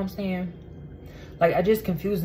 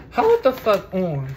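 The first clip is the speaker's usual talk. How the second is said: angry; 4 semitones higher, slower than usual. Real, not acted. Discovers burger missing from order.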